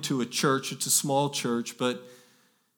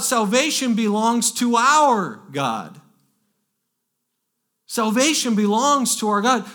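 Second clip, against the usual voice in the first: yelled out; 7 semitones higher, 5 dB louder than usual. Retold or imitated. Preacher voicing heaven's multitude before the throne, yelled out. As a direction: yell it out like a great multitude. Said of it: "They, they yelled out".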